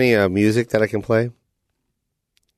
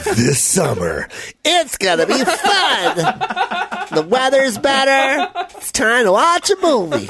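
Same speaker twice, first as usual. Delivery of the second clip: mocking trailer voice. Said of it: "That was Kayla to a T".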